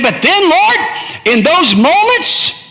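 high, loud and slow; fired-up cry. Acted. Preacher voicing Jeremiah burning with God's word, high and loud. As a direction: let the fire burst out. Prophet's fire rising again.

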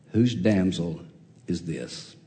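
low, soft, trailing question; quiet awed Boaz; hushed wonder. Acted. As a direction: say it almost to yourself, falling away. Preacher repeats line hushed.

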